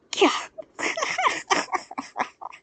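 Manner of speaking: high barking scornful laugh; sharp derisive cackle; mocking laugh. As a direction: brute laughing at him. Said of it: "the brute laughed".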